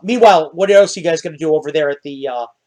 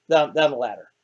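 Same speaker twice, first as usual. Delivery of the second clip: ordinary talk, nothing marked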